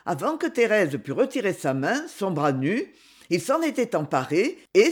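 usual speaking voice

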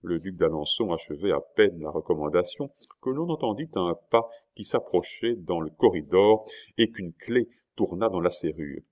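plain reading voice

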